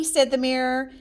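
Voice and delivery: plain reading voice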